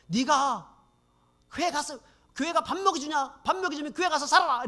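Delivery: high, loud mocking shout; retold yelling. Personnel clerk yelling at the narrator outside the office, loud mocking shout. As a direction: bellow it at him like a furious coworker. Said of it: "소리를 지르고".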